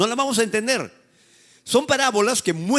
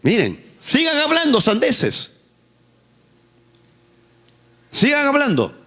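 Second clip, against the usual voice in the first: preaching rebuke; 5 semitones higher, 4 dB louder than usual. Real, not acted.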